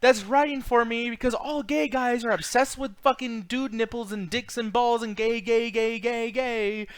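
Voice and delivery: laughing parody of a musical number; mock musical character. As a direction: ham up a cheesy song lyric. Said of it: "he's like".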